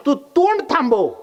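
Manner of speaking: high, emphatic silencing order; acted exasperated command; sharp rebuke. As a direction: shut the noisy neighbour up. Wife tells neighbour to shut up.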